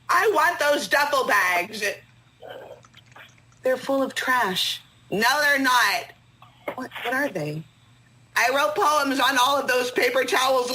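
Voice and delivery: ordinary delivery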